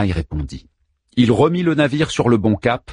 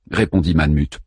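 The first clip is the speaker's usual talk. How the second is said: usual speaking voice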